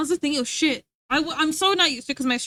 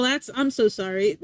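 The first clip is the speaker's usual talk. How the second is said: ordinary talk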